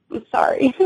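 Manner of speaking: choked up from retelling it; tearing up. Real, not acted. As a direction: apologise through welling tears. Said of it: "gonna tear up just".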